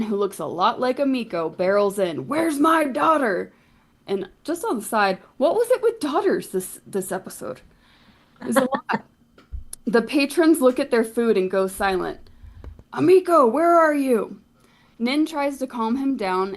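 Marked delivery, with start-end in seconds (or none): 2.3-3.5 higher, slow, gruff demand; angry father. Acted. Father bursting into the tavern for his daughter, angry demand. 12.9-14.4 higher, louder angry call. Acted. Father calling for Amico in the tavern, angry shout.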